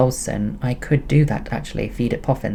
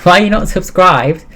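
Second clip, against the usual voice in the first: mock scold; 7 semitones higher, 8 dB louder than usual. Real, not acted. Teasing shout at viewer.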